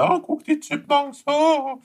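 a mock-amazed spectator oohing at the show; gawking onlooker. Friend mimics onlookers gawking at the Chipmunks, high mock awe.

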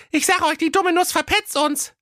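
put-on squeaky high worried girl; high cartoon princess worrying; high sister voice. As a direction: fret in a tiny high voice. Eldest sister fears betrayal.

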